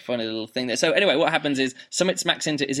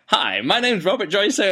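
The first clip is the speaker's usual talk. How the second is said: higher, chirpy mock greeting; author impression; 6 semitones higher, 3 dB louder than usual. Host imitates the author greeting listeners, perky and mocking. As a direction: imitate the author's cheery hello. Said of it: "And Robert's like".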